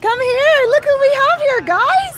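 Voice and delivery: high, bright calling to the viewers; excited calling out. Real, not acted. Vlogger calling her live viewers to meet her friend, high and excited. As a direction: call everyone over, thrilled.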